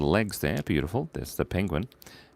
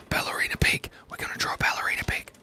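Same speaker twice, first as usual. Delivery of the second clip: unvoiced whisper, fast; quick whispered self-reminder; 4 dB quieter, faster than usual. Real, not acted. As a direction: murmur the plan under his breath. Muttering the plan to himself.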